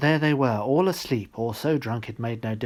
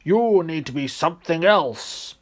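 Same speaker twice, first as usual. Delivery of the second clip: louder, pitched-up gloating giant; deep satisfied voice; 5 semitones higher, 5 dB louder than usual. Acted. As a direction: pronounce his fate with relish. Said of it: "in a deep and extremely satisfied voice".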